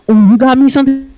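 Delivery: high-pitched imitation of a kid; cutesy little girl. Classmate snatching his snack, high girlish imitation. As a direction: mimic a girl expecting a treat.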